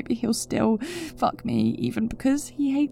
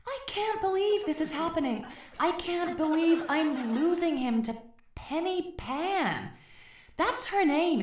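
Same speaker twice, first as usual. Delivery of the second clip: high, strained despair; distraught; 9 semitones higher, 3 dB quieter than usual. Acted. Woman losing her man to a rival, high distraught voice. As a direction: wail that you're losing him.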